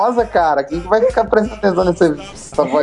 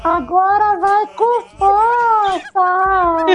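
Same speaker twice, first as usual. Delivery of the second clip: high effect voice; much slower than usual. Pretend. Mock cry, effect voice.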